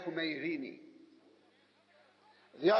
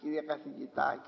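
lower, respectful "Κύριε καθηγητά" with stress on "κύριε"; deferential address; 6 semitones lower, 3 dB quieter than usual. Shown as a demonstration. Preacher showing how we address the professor, deferential. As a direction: say "Κύριε καθηγητά" humbly, head bowed. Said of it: "σε πιο κύριο καθηγητά".

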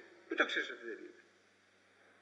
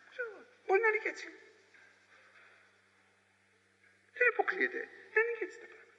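very high, quick shrugging voice; evasive doubter; 13 semitones higher, much faster than usual. Acted. Preacher mimicking an evasive believer, high and quick shrugging. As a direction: play a dithering skeptic who won't commit.

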